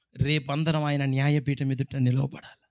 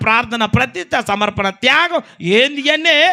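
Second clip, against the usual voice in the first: much higher and louder, jeering at Noah; mocking shout; 10 semitones higher, 13 dB louder than usual. Mocking crowd jeers at Noah, loud high scornful yelling. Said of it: "ఇప్పటిదాకా ఏమని కేకలేసిండ్రు?".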